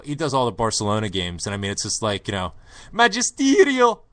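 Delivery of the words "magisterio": very high, loud commentator cry; excited Geordie commentator impression; Ray Hudson. Imitating a football announcer for the co-host, high excited yell. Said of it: "if you're not familiar with Ray Hudson".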